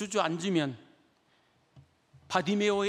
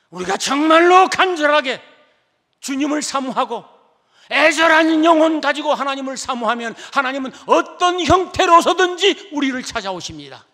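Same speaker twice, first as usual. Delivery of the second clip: loud, higher fervent preaching; full-force preaching; 8 semitones higher, 12 dB louder than usual. Acted. Preacher urging the congregation to long for God, fervent and loud. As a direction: drive the promise home to the congregation.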